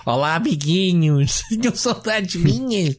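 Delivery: slow, bright sing-song greeting; Mark Cerny sing-song welcome. Retold or imitated. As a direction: coo it like a cheery host. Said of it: "Mark Cerny outra vez".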